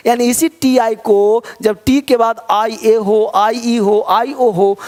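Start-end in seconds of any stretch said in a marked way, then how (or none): none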